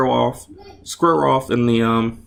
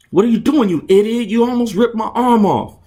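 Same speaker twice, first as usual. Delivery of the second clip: high, loud furious yelling; angry shouting; 12 semitones higher, 4 dB louder than usual. Acted. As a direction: yell at him in outrage. Opponent furious after arm bar.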